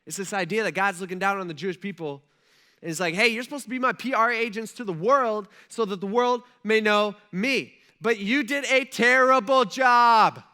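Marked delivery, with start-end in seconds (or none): 3.1-10.4 scolding. Retold or imitated. God scolding his people.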